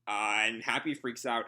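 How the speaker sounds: normal talking voice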